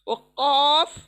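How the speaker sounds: कॉफ struck loud at the uvula; deep qaf. Shown as a demonstration. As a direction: hit कॉफ at the back of the throat. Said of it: "छोटी ज़ुबान है हमारी हलक में". (छोटी ज़ुबान से कॉफ)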